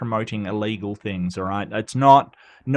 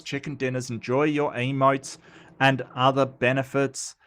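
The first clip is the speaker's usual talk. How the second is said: ordinary talk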